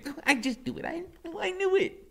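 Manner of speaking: giddy from the kitchen; high giddy woman. A narrator's reading. Loretta gloating from the kitchen, high and giddy. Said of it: "hear her laughing and saying".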